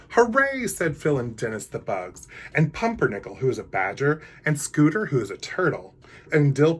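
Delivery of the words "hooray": high, loud happy cheer. Acted. The garden friends cheering the wedding news. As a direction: burst out with joy.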